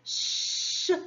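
"ch" as a long voiceless shhh; isolated ch sound. Shown as a demonstration. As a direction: hold "ch" with no vowel. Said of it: "On garde". (« ch » prolongé, sans voyelle)

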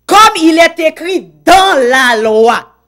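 preached quote. Acted. Même citation, plus haute et forte.

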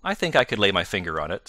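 ordinary delivery

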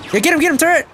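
high urging cheer; excited cheering. Real, not acted. Player cheers his turret to get the kill, high and excited. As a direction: egg the turret on, thrilled.

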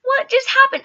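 just woke up confused; bewildered. Retold or imitated. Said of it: "I'm looking like".

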